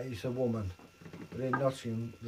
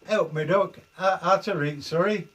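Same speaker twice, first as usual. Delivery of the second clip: higher, louder Derbyshire miner greeting; broad Derbyshire dialect, pitched up and punchy; Derbyshire miner accent; 5 semitones higher, 7 dB louder than usual. Shown as a demonstration. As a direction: greet loudly like a Derbyshire pit man. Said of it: "a miner would have said".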